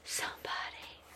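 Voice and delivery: soft unvoiced whisper; whispered echo. Real, not acted. Repeating her plea for help, a whisper. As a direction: breathe the word out as an echo.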